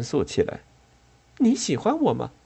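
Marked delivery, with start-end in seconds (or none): 1.4-2.3 put-on higher, earnest girl's question; higher female voice. A narrator's reading. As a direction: ask sincerely, up high. Aisha asks Jack earnestly.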